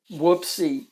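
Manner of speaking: ordinary delivery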